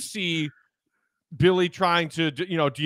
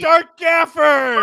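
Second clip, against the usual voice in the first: high, loud, drawn-out mock scream; imitation of fans screaming from the stands; 12 semitones higher, 10 dB louder, much slower than usual. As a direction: yell it like an impatient crowd demanding a change. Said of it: "People out there were screaming".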